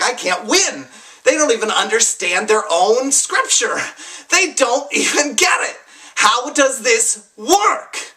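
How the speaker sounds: high, exasperated outcry; can't-win frustration boiling over; reliving angry helplessness over scripture; angry despair. Retold or imitated. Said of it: "I was mad".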